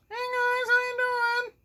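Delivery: very high, squeaky cartoon mouse greeting; Mickey Mouse voice. Teacher voicing a toy Mickey to greet the class, high and squeaky. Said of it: "It's Mickey Mouse".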